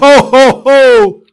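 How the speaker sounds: booming high Santa laugh; jolly ho ho ho. Shown as a demonstration. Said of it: "Can you give us a Santa laugh".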